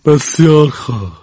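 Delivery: slow, stern teacher voice; teacher relenting. Acted. Mrs. Pierce lets group two off, slow and stern. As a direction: grant it slowly.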